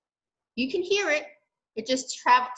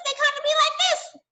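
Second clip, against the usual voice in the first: much higher, squeaky voice showing a high sound; high squeaky demo. Shown as a demonstration. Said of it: "But those really high ones".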